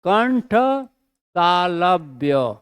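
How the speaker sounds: कंठ तालव्य drawn out slowly; slow dictation. Shown as a demonstration. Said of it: "इसका उच्चारण स्थान कंठ तालव्य".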